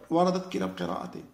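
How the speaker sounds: ordinary talk, nothing marked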